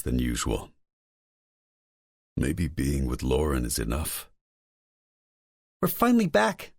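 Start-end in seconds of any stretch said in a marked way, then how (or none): 5.8-6.7 high excited squeal. A narrator's reading. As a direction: squeal it with delight. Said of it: "she squeals".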